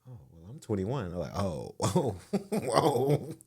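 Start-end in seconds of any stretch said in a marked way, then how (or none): none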